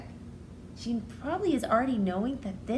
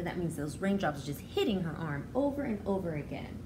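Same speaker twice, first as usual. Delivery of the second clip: ordinary delivery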